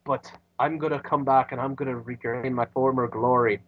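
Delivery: put-on Scottish accent that slides into Irish; failed Scottish accent. Host playing McIntyre's vow, accidental Irish accent. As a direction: aim for Scottish, land on Irish. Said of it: "that's still my Irish accent".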